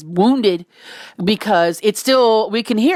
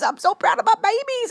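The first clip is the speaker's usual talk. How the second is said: very high-pitched squeal of pride; proud squeal; 13 semitones higher than usual. Real, not acted. Repeats it as a squeal.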